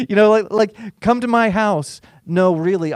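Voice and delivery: high, loud, laughing imitation; giggling as the centurion waves Jesus in; laughing mock invite. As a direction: ham up the host's invite through laughter.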